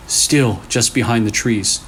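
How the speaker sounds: plain reading voice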